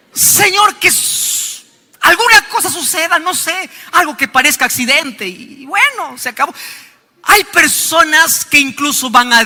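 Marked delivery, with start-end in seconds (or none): 0.1-6.5 mock spiteful prayer. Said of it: "hay muchos que así oran".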